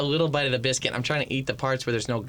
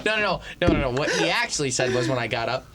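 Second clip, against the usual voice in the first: ordinary talk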